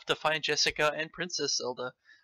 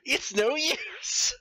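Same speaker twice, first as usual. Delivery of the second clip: slow villain sneer; 11 semitones higher, much slower than usual. Acted. Ganondorf mocking.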